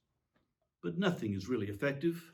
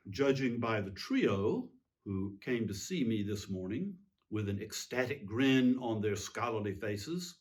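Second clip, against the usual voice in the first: ordinary talk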